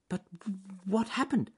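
high-pitched little rabbit voice; concerned rabbit. A narrator's reading. Rabbit asking the hurt duck what happened, high and concerned. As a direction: squeak out a worried question.

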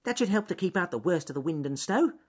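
high little rabbit voice; Ernest Fluffy Rabbit. A narrator's reading. Rabbit inspecting his gate, high and self-satisfied. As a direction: think aloud in a high rabbit pitch. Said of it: "said Ernest Fluffy Rabbit to himself".

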